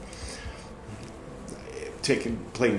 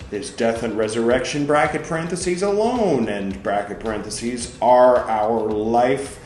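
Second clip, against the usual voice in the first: loud, emphatic reading of Luther's words; forceful sermon reading; 6 semitones higher, 9 dB louder, slower than usual. Acted. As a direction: argue it out from the pulpit. Reads Luther's text aloud.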